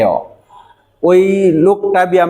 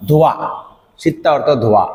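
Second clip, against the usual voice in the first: ordinary talk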